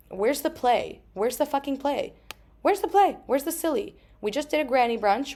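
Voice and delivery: put-on high, demanding kid voice; mad inner child. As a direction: whine and demand like a cross child. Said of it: "she had that kind of energy".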